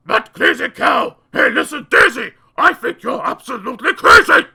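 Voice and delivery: loud rude heckler; yelling. Acted. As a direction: jeer at the cow. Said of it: "yelled aloud".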